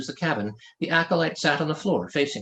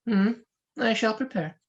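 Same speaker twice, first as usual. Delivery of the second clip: calm monk voice; 8 semitones higher than usual. Acted. The monk replies.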